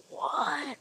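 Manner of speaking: high-pitched baffled reaction; comic, incredulous high "what"; imitated disbelief. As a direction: mimic someone utterly baffled. Imitating baffled people overseas.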